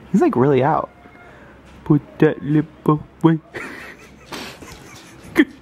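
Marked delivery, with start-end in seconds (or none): 1.9-3.4 separate, evenly spaced words; playful word-by-word order; teasing baby command. Pretend. First of four escalating takes.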